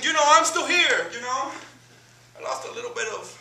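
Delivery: a giddy hometown pal catching up; starstruck old buddy. Retold or imitated. Voicing Ismael at length.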